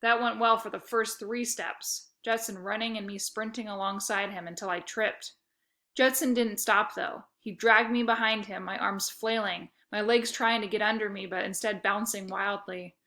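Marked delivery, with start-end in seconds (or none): none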